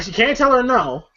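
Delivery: ordinary talk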